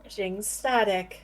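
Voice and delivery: robotic voice crackling with static; flat machine voice powering down. Acted. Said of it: "I can make my s-, voice sound like a robot".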